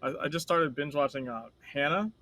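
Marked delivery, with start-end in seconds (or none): none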